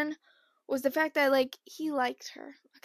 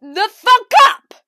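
furious yell, higher and louder; 10 semitones higher, 18 dB louder, much slower than usual. Retold or imitated. John B screaming at the annoying dream companion. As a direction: scream it at her in a rage. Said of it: "He'd be like".

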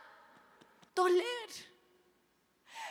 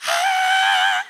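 loud drawn-out scream; retold scream; 4 dB louder than usual. Girl being waxed without warning, acted scream. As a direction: scream out in mock agony. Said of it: "empecé a gritar".

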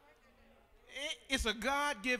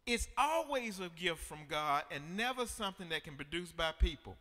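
normal talking voice

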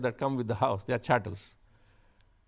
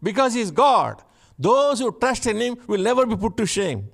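high, loud, building sermon cry; fiery preaching; 8 semitones higher, 6 dB louder than usual. Acted. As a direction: preach it out to the whole church at full force. Preaching with rising force.

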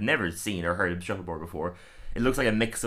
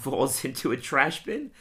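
ordinary talk

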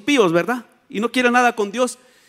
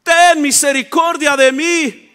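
high, loud, drawn-out cry; pleading shout; 9 semitones higher, 10 dB louder, slower than usual. Acted. Blind man begging the son of David for mercy, shouting. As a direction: cry out over those silencing you. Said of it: "yo solamente grité".

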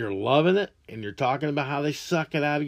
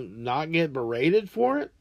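normal talking voice

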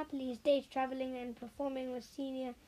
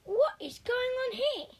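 much higher, louder, slow, raging demand; furious; 11 semitones higher, 7 dB louder than usual. A narrator's reading. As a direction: boom it out at the crowd in fury. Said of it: "voice charged with fury".

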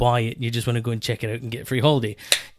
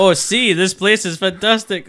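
mock enthusiasm; 5 semitones higher, 4 dB louder than usual. S1 mocks a show guest.

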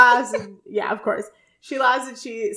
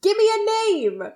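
high squeak; 12 semitones higher than usual. Retold or imitated. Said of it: "he's just like".

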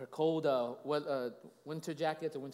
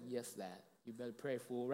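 low, soft conspiratorial aside; mock sly aside; 6 semitones lower, 10 dB quieter than usual. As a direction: say it low, as with a wink. Wink exchange with his son, retold.

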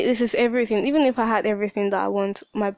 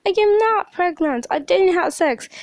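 much higher, louder protest; indignant denial; 9 semitones higher, 5 dB louder than usual. Retold or imitated. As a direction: insist hard you did nothing. Denying to parents.

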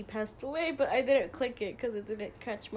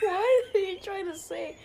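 higher, louder, asked through laughter; laughing question; 10 semitones higher, 6 dB louder than usual. Real, not acted. Laughing at her friend's nonsense about the goat. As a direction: laugh it out in disbelief.

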